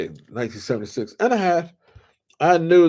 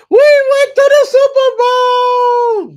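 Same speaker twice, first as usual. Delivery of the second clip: very high, loud, slow victory yell; a proud fan shouting the win; triumphant shout; 12 dB louder, slower than usual. Real, not acted. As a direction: bellow it like a champion. Triumphant payoff of story.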